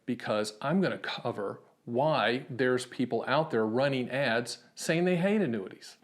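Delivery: normal talking voice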